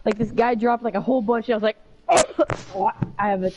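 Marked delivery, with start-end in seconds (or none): 1.8-3.1 throat-tickle cough; a short dry cough. Real, not acted. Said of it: "I got a little tickle in my throat".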